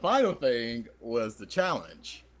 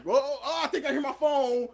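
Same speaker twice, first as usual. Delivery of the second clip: high flustered voice; 13 semitones higher than usual. Retold or imitated. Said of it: "I'm like".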